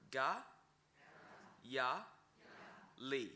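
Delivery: ga, ya, li spoken one syllable at a time with pauses. Shown as a demonstration. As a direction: break ga, ya, li into separate syllables.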